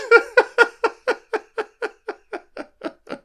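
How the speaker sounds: loud, high burst of laughter. Real, not acted. Instructor cracking up at his own joke with a high, loud laugh.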